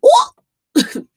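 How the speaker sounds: sudden piercing "ooh"; high loud whoop. Real, not acted. Streamer after a jab at the label, high whoop.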